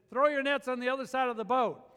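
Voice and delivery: firm call from the shore. Retold or imitated. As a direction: shout the instruction out to the boat. Jesus calls to boat.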